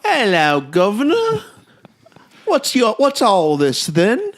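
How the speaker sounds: high, loud mock-Cockney accent; squawking, badly faked Cockney. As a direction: ham up a terrible London accent. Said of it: "my second one are bad accents".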